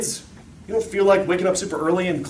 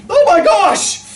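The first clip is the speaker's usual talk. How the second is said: high, loud shriek of alarm; imitated scream; 14 semitones higher, 8 dB louder, much slower than usual. Wife screams from the other room, high imitated shriek. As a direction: cry out in panic, imitating a frightened mother. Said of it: "I heard the scream".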